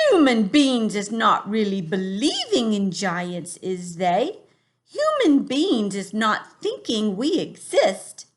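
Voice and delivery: put-on a big friendly giant in a funny English accent, drawn out. Teacher voicing the giant for her class, funny accent. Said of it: "give him a funny accent too".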